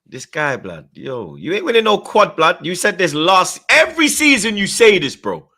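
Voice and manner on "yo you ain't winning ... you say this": high, loud rant building to a peak; exasperated outburst. Real, not acted. Host rebuking a fan's quad prediction, exasperated outburst. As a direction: shout it in disbelief, higher and higher. Disbelieving rant at a chat message.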